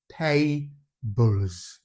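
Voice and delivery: "tables" split into tay, bulz; voiced plural ending. Shown as a demonstration. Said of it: "when you add the S to it".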